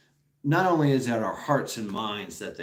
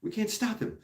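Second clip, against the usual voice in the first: mock panic, much higher pitch; panicked British soldiers; 11 semitones higher, 4 dB quieter than usual. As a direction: squeal it like flustered redcoats. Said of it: "They're like".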